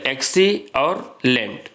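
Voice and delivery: "XC और lent" slowly, each part set apart; teacher separating "XC" from "lent" with small pauses; split into syllables. Shown as a demonstration. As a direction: count out the two chunks XC, then lent. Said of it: "दो syllable है".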